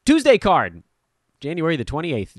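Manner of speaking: ordinary delivery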